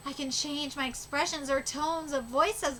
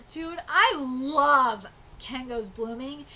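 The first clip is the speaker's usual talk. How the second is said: ordinary talk, nothing marked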